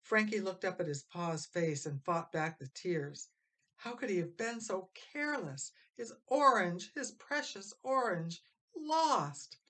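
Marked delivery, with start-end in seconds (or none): 3.8-9.6 rising, anguished lament; despairing. A narrator's reading. Narrator voicing the boy's despair, anguished and climbing. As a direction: let it climb to a near cry.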